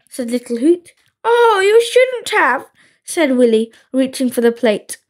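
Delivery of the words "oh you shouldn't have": high, cooing false modesty; pretend grandma. A narrator's reading. Willy as Grandma accepting cookies, falsely flattered. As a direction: coo it like a flattered granny.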